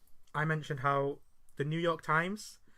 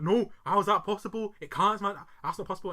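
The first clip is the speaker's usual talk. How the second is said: put-on loud, higher, strong-accented protest; mock man-voiced child; 6 semitones higher, 7 dB louder than usual. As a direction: imitate an indignant boy who talks like a grown man. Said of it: "he's got the strongest man accent".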